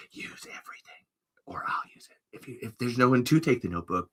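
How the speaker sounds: hushed, conspiratorial hand-off; secretive whisper. Pretend. Whispering to the successor while handing over the notebook. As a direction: press the notebook on them in a whisper.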